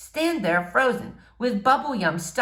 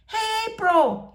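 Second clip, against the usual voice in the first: high, drawn-out call across the room; mock scream; 14 semitones higher, slower than usual. A narrator's reading. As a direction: yell her name to get attention. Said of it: "he screams".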